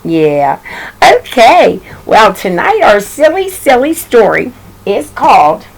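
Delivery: plain reading voice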